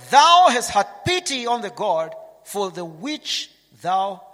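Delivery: loud, slow, commanding; preacher thundering the Lord's reply to Jonah; booming voice of God. Acted. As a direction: proclaim it like God from on high. Preacher booms God's words.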